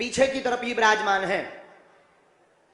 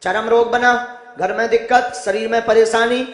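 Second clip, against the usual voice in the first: solemn proclamation; 8 dB louder than usual. Acted. Guru proclaims the devotee's troubles.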